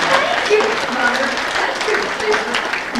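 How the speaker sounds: high, ringing cry of thanks; joyful praise. Real, not acted. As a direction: lift it up in grateful praise. Grateful praise after the story.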